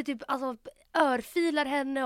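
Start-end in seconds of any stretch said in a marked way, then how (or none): none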